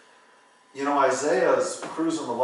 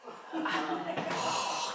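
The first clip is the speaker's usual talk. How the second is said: high, breathless mock laugh of disbelief; imitated laugh; 6 dB quieter than usual. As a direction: laugh like the stunned friend in the produce aisle. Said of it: "and he's like".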